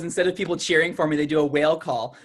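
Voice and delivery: ordinary delivery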